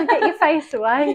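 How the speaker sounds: loud, high alarmed warning; alarmed mum. Retold or imitated. Mum warning her daughter away from the dog, alarmed. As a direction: snap it like a worried mum. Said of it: "she'd be like".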